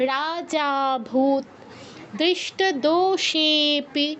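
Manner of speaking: রাজা ভূত দৃষ্ট দোষেপি very slowly with the জা and সে held; slow recitation. Shown as a demonstration. Teacher recapping the long-vowel examples slowly for the class. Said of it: "তাহলে কি হচ্ছে?".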